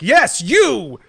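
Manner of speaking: loud, high excited call; mock Ollivander. Ollivander calling a distracted parent up, loud. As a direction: call them out loudly. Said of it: "And you're like".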